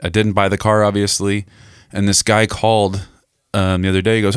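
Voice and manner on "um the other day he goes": ordinary talk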